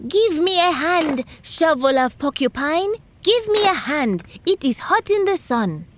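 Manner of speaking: porcupine's bright high call to his magic shovel; porcupine shouting. Acted.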